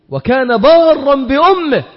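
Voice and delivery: ordinary talk, nothing marked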